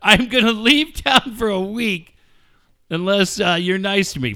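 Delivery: hammed-up wrestler whine; whiny wrestler threat. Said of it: "You're like the W W E guys".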